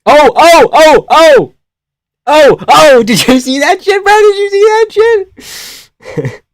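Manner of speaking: very high, loud shrieks of disbelief; real high-pitched frenzy, stretched at the end; excited yelling. Real, not acted. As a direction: lose it over a wild moment. Reacting to live fight action.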